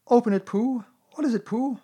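high, eager little voices; excited clamour. Acted. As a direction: urge him on excitedly. Everyone clamours round the present.

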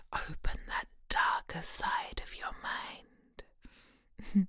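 slow, soft whisper right at the ear. Acted. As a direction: breathe the words into his ear. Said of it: "I'm gonna whisper into your ear".

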